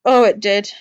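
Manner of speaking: ordinary talk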